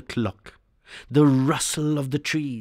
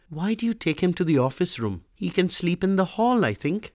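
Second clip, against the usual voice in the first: lightly voiced mother, higher and quick; mother pleading; 7 semitones higher than usual. A narrator's reading. Wife asking husband to spare the boy, higher and quick. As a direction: hurry a mild plea for the son.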